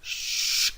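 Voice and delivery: hushing shh, no voice; the shh of English she; sh sound. Shown as a demonstration. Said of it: "estas palabras se pronuncian así".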